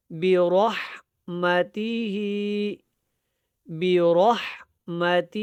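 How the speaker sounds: slow برحمتہ with a heavy r; deliberate recitation, thick r in برحمتہ; full ra. Shown as a demonstration. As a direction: round the r of برحمتہ, then a clear breathy h. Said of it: "را پر ہے یہاں پہ".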